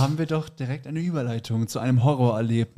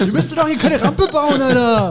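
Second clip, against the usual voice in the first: high loud laughing yell; loud mocking shout; 12 semitones higher, 6 dB louder than usual. Imitated heckle, loud and high.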